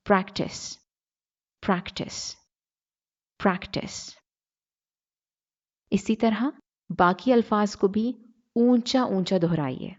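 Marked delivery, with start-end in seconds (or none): 0.1-4.0 प्रैक्टिस with a faint ठ breath on the T, getting softer each time; light ठ in practice. Shown as a demonstration. Teacher drilling learners on प्रैक्टिस, light ठ. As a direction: repeat प्रैक्टिस with a light aspirated T. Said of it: "हल्का सा ठ, ठ sound है".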